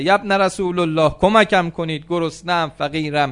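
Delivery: high, loud calling plea; pleading beggar. Acted. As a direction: call out for alms like a blind beggar. Blind beggar calls out for alms.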